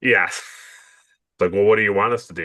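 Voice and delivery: ordinary talk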